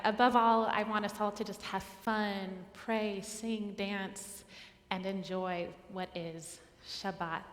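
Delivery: usual speaking voice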